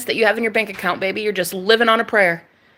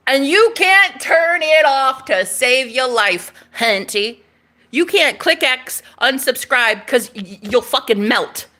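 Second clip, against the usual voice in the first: ordinary talk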